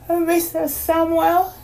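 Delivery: put-on high, sleepy girl voice; a tired little girl asking sweetly; young girl voice. A narrator's reading.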